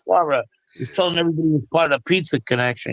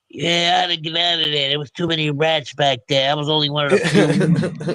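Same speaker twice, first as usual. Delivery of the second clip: a hint of Italian American accent, laughing at the end; light wiseguy Italian American accent, mocking. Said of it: "Starts doing the Italian American accent".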